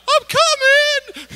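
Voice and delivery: very high, slow squeaky call; wife running out with a little pail, squeaky cry; cute eager cry. Retold or imitated. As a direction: play her as a sweet eager helper.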